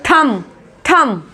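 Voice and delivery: थंब slowly as "thum"; silent b demo. Shown as a demonstration. Teacher drilling the word for thumb, silent b. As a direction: end each थंब on m, no b. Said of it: "ये क्या बोलेंगे अंगूठे को".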